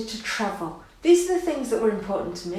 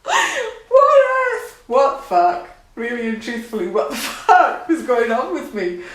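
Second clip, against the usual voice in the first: incredulous laughter, louder; laughing disbelief; 5 semitones higher, 5 dB louder than usual. Real, not acted. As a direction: squeal then laughing swear. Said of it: "what I'm laughing for".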